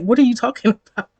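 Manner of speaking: laughing disbelief; incredulous laughing. Retold or imitated. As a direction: crack up halfway through the question. Breaks into laughter mid-quote.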